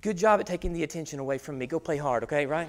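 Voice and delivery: soft, fast, low hissed warning; whispered threat. Retold or imitated. Father quietly threatening son on the field, low and fast. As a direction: threaten him under your breath. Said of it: "to whisper a yell and a threat".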